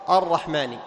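normal talking voice

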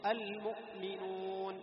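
very slow, chanted المؤمنون with a brief stop at ؤ; hamza cut short. Shown as a demonstration. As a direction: cut the sound at the hamza in المؤمنون, then lengthen the ending. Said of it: "ينقطع الصوت".

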